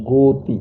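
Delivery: গতি said as "go-ti", round o; o sound after গ. Shown as a demonstration. Teacher shows students that o joins the g, pronunciation demo. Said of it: "ও যুক্ত করে আমরা উচ্চারণ করছি".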